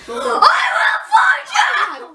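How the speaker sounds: high, shouted mock threat; hammed-up roar of revenge; raging dad. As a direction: yell it like a furious dad.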